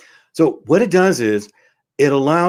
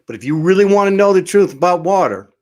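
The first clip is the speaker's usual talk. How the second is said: higher, louder and faster; rising excitement as he promises the truth; emphatic build-up; 6 semitones higher, 4 dB louder, faster than usual. Real, not acted. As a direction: push it like the big reveal. Building up the big reveal.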